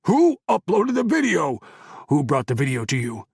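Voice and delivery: loud angry demand; furious. Acted. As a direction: shout the question at him. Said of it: "team leader's fury".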